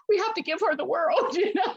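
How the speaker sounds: rally everyone to spoil the bride; fervent high cry into laughter; passionate exclamation. Retold or imitated. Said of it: "I'm just like".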